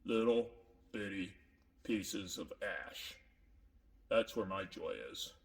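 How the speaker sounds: gloomy and subdued; sad. Acted. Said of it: "Makes me kinda sad".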